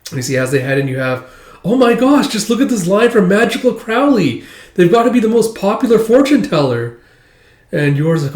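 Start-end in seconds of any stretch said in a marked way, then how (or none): 1.6-7.0 much higher gushing schoolgirl; gushing girl. Retold or imitated. Becky gushing over the popular fortune teller, high excited.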